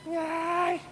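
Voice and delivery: high yelp of pain, mildly voiced; mock burning scream. Preacher imitating the man's scream under the teacher's hand. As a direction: squeal like something burning. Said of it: "ele deu um grito, parecia que tava queimando".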